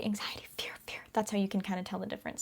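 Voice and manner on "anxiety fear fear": whispering. Real, not acted. Whispered aside.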